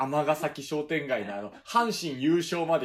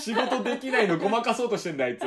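laughing; 6 semitones higher, 8 dB louder than usual. Real, not acted. 後輩を笑いながら責める.

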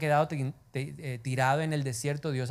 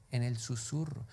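much softer, lower; 5 semitones lower, 9 dB quieter than usual. Shown as a demonstration. Preacher answering his own question, hushed. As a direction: drop to a hush. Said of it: "hablar ¿en dónde?".

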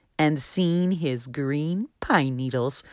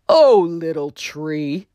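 deep voice; 5 dB louder, slower than usual. Acted. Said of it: "a deep voice drifted over".

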